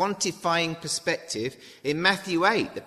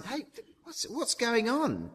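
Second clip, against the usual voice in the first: high, lightly voiced puzzled brother; a hint of a dim older son asking around; 9 semitones higher than usual. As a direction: call out casually, a bit slow on the uptake. Older brother asks a servant.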